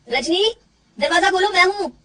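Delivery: husband calling his wife to open up; calling through door. Acted. (दरवाज़े के बाहर से पुकारना)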